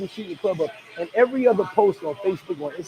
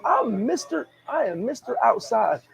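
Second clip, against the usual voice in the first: ordinary talk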